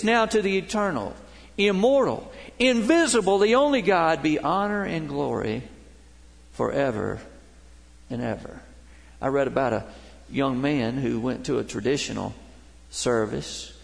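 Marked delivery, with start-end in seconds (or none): 0.0-8.6 rising, louder praise, fading on forever and ever; preaching proclamation. Acted. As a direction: proclaim the doxology, then settle. Scripture doxology proclaimed.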